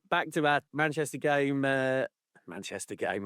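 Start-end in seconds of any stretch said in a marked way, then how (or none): 2.5-3.3 American accent. Real, not acted. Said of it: "I sound like an American".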